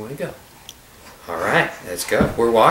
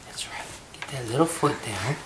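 normal talking voice

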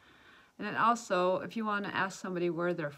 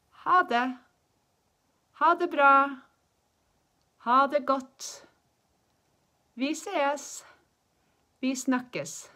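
high, loud goodbyes with clear gaps; language-teacher demonstration; 8 semitones higher, 10 dB louder, much slower than usual. Shown as a demonstration. Teacher showing different ways to say bye, loud demonstration. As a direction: project each farewell for learners to copy.

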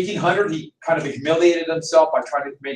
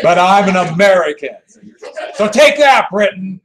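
laughing defiance; 7 dB louder than usual. Real, not acted. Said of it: "laughing defiant joke".

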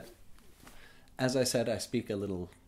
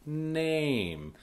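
NAME drawn out, high then falling; long A sound; 5 semitones higher than usual. Shown as a demonstration. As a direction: hold the A in NAME slowly. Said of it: "it's a long A sound".

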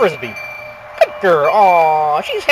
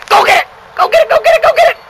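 rising, higher with each shout; excited cheering; 12 semitones higher, 5 dB louder, much faster than usual. Real, not acted. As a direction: fire the dog up to fetch. Urging dog to fetch frisbee.

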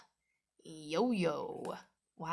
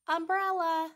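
excited reveal; 10 semitones higher, 7 dB louder than usual. Acted. High, loud reveal of the umbrella.

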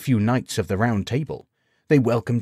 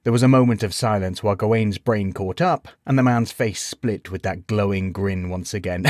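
plain reading voice

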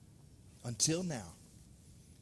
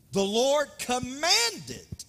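"commanded" drawn out slowly and louder; stress on commanded; 3 semitones higher, 5 dB louder, much slower than usual. Real, not acted. Preacher reading Genesis to the congregation, "commanded" stretched with force. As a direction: lean long and hard on "commanded". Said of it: "I want to emphasize the word commanded".

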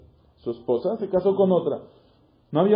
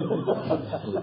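soft breathy laugh; 8 dB quieter than usual. Real, not acted. Laugh after the joke.

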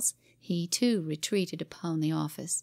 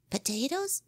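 put-on boy's voice; 6 semitones higher than usual. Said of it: "said Jimmy".

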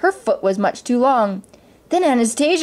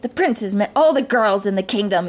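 usual speaking voice

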